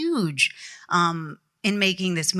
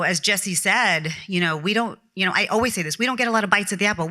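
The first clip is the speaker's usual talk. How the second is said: ordinary delivery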